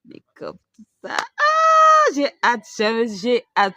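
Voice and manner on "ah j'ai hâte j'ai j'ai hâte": loud, high cry of excitement; can't wait, bursting out; excited shout. Real, not acted.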